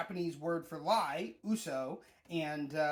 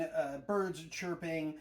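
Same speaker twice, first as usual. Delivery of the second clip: normal talking voice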